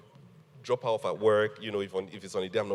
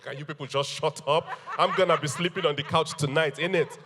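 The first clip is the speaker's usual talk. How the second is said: mock exasperated plea through laughter, a bit higher and louder; laughing plea; 5 semitones higher, 5 dB louder than usual. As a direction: beg the crowd to hush while laughing. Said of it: "I'm like".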